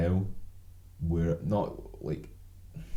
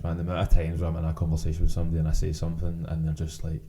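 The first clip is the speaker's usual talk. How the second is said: ordinary talk, nothing marked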